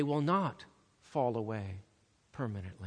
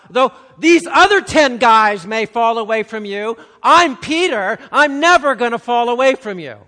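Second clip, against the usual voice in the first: much higher, much louder boastful outcry; crying out; 9 semitones higher, 18 dB louder than usual. Retold or imitated. Peter boasting loudly to Jesus, shouting his loyalty. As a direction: shout it like a man sure he'll never fail. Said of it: "Peter cries out".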